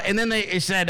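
ordinary talk, nothing marked

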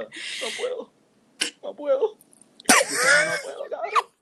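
high squeaky tearful protest; mock sobbing. Answering the girl who asks what's wrong, mock sobbing. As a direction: build from a thin whimper to a drawn-out wail. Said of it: "Y yo".

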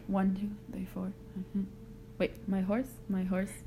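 softly counting under the breath; counting syllables. Real, not acted. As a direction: count quietly to yourself, beat by beat. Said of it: "[name] is currently counting the syllables".

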